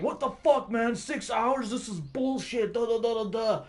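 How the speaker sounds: high, exasperated complaining, a mimicked rant; mock the fed-up drivers griping at the flagger; mock angry yelling. Drivers griping at the flagger about the delay, in an annoyed mock rant. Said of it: "Everybody apparently was yelling at her".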